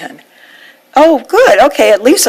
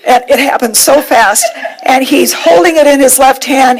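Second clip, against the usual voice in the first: ordinary delivery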